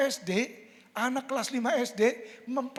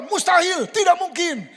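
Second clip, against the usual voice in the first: higher, louder scoffing proclamation; voice of the world; 9 semitones higher, 11 dB louder than usual. Acted. Preacher voicing the world's doubt, loud and scoffing. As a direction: declare it impossible with full force. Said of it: "Dunia berkata".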